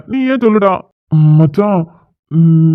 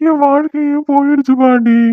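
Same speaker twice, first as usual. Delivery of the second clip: high, wailing complaint to grandma; whiny fake crying; 4 semitones higher than usual. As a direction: play up the tears to grandma. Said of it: "இப்படி அழுதுட்டு உட்கார்ந்திருக்கான்". (பாட்டியிடம் பாசாங்கு அழுகை)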